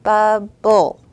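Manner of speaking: loud two-beat bub-ble; syllables pounded out. Shown as a demonstration. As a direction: pound each beat of Bubble. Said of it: "Let's pound it out".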